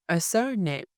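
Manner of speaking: ordinary talk, nothing marked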